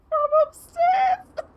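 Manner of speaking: very high, slow, squeezed by laughing; helpless laughter. Real, not acted. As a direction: barely get it out while cracking up. Cracking up over the mimicking yell.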